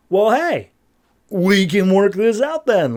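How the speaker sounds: high, playful mock come-on; suddenly interested. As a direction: act out a sudden change of tune. Ardora suddenly turns inviting.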